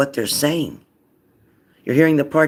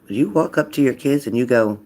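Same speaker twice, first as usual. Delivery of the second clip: normal talking voice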